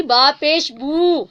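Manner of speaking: با پیش بو drawn out very slowly with lips rounded on بو; rounded lips damma. Shown as a demonstration. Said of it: "دونوں ہونٹوں کو گول کر کر جو ہے پیش ادا ہوگی". (ہونٹ گول کر کے بہت آہستہ)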